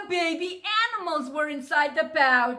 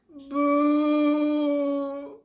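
sheep bleat. Acted. Sheep cries in dismay.

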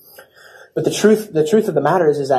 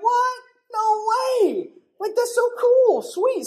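very high squealed excitement; mock thrilled; slower than usual. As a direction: gush in falsetto like a giddy winner. Acts out thrilled reaction to winning.

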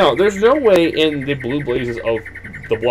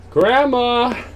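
high, drawn-out playful call; 11 semitones higher, 4 dB louder than usual. Pretend. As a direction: call into the house for grandmother. Calls out to absent grandmother.

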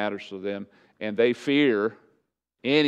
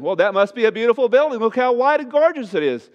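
mock admiration; 11 semitones higher, 6 dB louder than usual. Mock admiring the whitewashed tomb.

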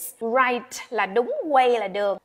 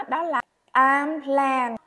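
normal talking voice

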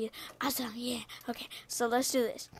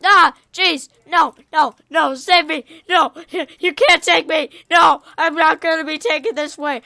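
loud, high cries for help; playful panic; 4 semitones higher, 14 dB louder than usual. Pretend. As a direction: yell in protest as slimes close in. Slimes chase him in game.

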